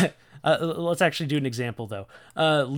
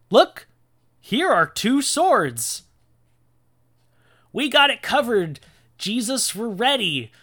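high, loud mock-heroic boasting; hammed-up, high-pitched gung-ho excitement; overeager disciples; 9 semitones higher, 7 dB louder, slower than usual. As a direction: announce it proudly, cluelessly confident. Acts cocky, clueless disciples.

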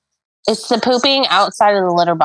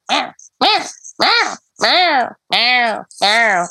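high, insistent meows sliding back down; imitating a yelling cat; 4 semitones higher, much slower than usual. Fed-up owner mimics her cat yelling at her all day, imitated meows. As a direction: meow like a nagging cat. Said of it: "She yells at you".

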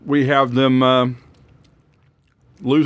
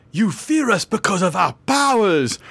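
high, slow, dramatic hero line; lightly voiced earnest mutant; dramatic Jubilee; 6 semitones higher than usual. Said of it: "Jubilee is like".

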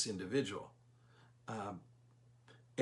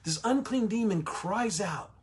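raised higher and slowed, dramatic; dramatic reading; 7 semitones higher than usual. Shown as a demonstration. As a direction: read it like a climax in the story. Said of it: "I was trying to be a little dramatic there".

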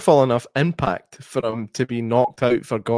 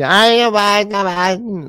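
imitating old lady; 9 semitones higher, 5 dB louder than usual.

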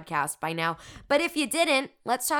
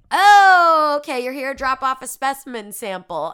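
loud receptionist; 5 semitones higher, 13 dB louder than usual. Retold or imitated. Mocking the hospital man, loud and high. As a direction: mimic the man, loud and much higher. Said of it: "he's like".

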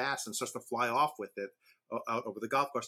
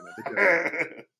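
laughing nudge; 6 semitones higher, 8 dB louder, slower than usual. Real, not acted. Urging cohost back to joke.

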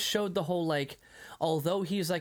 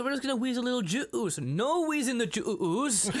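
mock shopkeeper; 7 semitones higher than usual. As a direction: stretch juice, mock-stern. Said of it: "And he goes".

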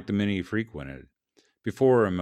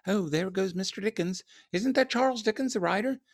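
very high-pitched mock onlookers; gossiping passersby; 13 semitones higher than usual. A narrator's reading. Writer mimics people pointing at him, high gossipy voice. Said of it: "point and whisper".

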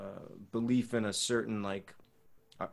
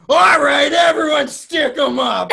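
much higher, loud shouting cop; 15 dB louder, slower than usual. Retold or imitated. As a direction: bark it like a TV cop taking over. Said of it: "you just turned into this cop".

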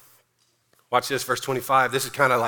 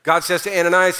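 ordinary delivery

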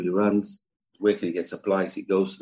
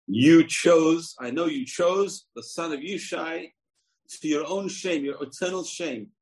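furious father king, voice raised high; angry king; 10 semitones higher, slower than usual. Acted. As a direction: rail at the prince for his disloyalty. King furious at his son.

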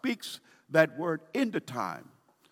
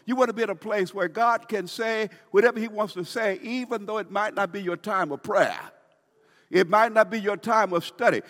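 higher, louder building exhortation; fervent preaching; 6 semitones higher, 5 dB louder than usual. Acted. Pastor urging listeners to stay open to God, high and loud. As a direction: build the urgency for the flock.

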